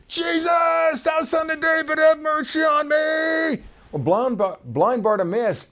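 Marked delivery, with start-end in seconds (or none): 0.1-3.6 high, far-carrying desperate cry. Acted. Blind beggar crying out to Jesus, desperate high shout. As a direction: plead at the top of your voice. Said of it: "he began to cry out and say".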